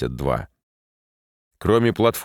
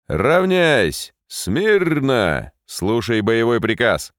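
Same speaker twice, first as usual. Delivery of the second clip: shouting drill sergeant; 4 semitones higher, much slower than usual. Acted. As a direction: bark the orders at the ranks. Commander orders the formation.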